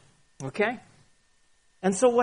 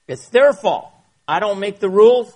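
loud, defiant mock excuse; blame-shifting outburst; 3 semitones higher, 11 dB louder than usual. Preacher mocks a sinner dodging blame, loud and defiant. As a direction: bark it out like a guilty man passing blame. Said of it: "Another one".

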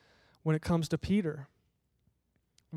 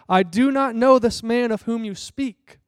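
higher, louder swearing denial; cursing denial; 5 semitones higher, 7 dB louder than usual. Acted. Peter curses and swears he doesn't know Jesus, loud and angry. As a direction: swear it off angrily. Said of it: "to invoke a curse on himself and to swear".

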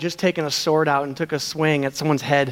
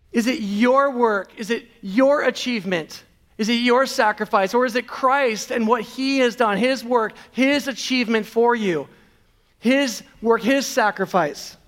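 raised-pitch questioning sermon; preaching; 6 semitones higher than usual. Acted. Preacher challenging the congregation, questions lifted and pointed. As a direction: press each question on the congregation.

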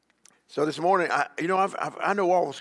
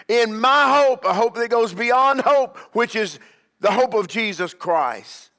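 rising, emphatic sermon cadence; fiery preacher driving the point home; preaching at full force; 6 semitones higher, 5 dB louder than usual. Acted. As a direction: preach it, building to each "Why?". Preaching climax.

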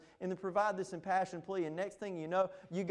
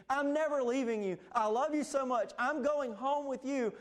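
higher, louder heartfelt pledge; devoted Orpah; 8 semitones higher, 4 dB louder than usual. Retold or imitated. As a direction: swear loyalty, high and loud. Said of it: "and she says".

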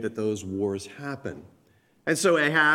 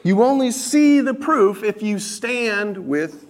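higher, louder preaching build to the point; fervent preaching; 7 semitones higher, 5 dB louder than usual. Acted. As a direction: drive the point home to the congregation. Preaching climax to congregation.

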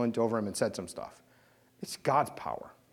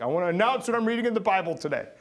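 mock announcement; 6 semitones higher, 5 dB louder, faster than usual. Mock blurting faith at work.

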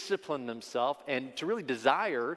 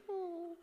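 high questioning hum; 9 dB quieter than usual. Shown as a demonstration. Manager leans in to a coworker with a high soft hum. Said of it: "like this".

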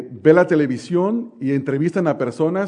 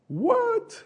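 sharp, much higher mock yell of a young woman; retold yell; 5 dB quieter than usual. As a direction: imitate the girl shrieking back in outrage. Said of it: "Y me empieza a gritar".

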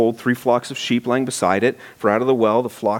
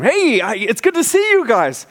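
much higher bubbly hello; eager greeting; 10 semitones higher than usual. Retold or imitated. Jacob hailing the shepherds, eager and much higher. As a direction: gush over meeting them. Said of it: "I could just see the enthusiasm".

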